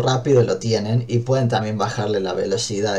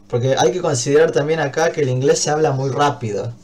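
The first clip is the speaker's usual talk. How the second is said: normal talking voice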